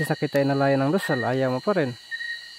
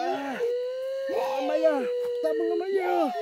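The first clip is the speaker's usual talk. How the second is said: high strained calls for help; high frightened calls to Amaya; desperate cry for help; much slower than usual. Real, not acted. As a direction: call out desperately for rescue.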